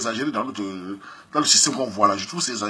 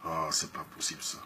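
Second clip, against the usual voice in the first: soft sympathetic ex; 12 semitones lower, 19 dB quieter than usual. Retold or imitated. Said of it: "À la fin, je te dis".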